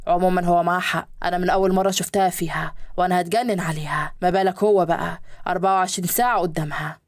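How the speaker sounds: men's voices in a read story; low male guests. Acted. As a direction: play the businessmen, voice dropped low.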